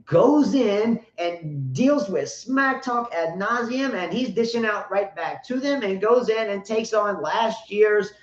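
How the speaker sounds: usual speaking voice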